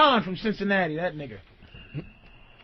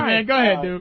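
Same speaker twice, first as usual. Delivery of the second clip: ordinary talk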